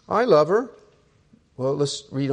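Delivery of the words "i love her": loud, higher protest; husband protesting the verse, mock indignant; defensive retort. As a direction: blurt it out in defense.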